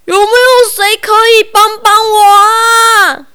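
roaring. Acted. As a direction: cry out like a roaring bear. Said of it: "大熊咆哮着".

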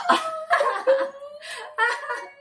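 high-pitched burst of laughing; long, real cracking-up laugh; laughter. Real, not acted. Storyteller hears he would faint, real high laughter.

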